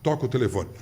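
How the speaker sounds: normal talking voice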